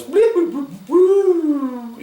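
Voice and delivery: very high, loud droid bloops; R2-D2 beeps. Retold or imitated. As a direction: chirp like a little robot. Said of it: "the one that goes".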